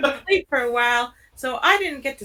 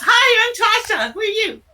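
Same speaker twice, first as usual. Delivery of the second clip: much higher and louder, bubbly hello; eager character greeting; 10 semitones higher, 5 dB louder, faster than usual. Acted. Tasha hopping up to greet the new person, high and eager. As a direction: bounce up to the stranger and chirp your name.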